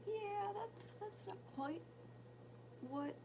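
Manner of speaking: high, soft, hesitant reply; retold gentle doubt. Retold mom humoring son, doubtful.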